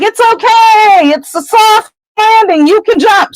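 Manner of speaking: Harry calling up reassuringly. Acted. Harry calls up the shaft.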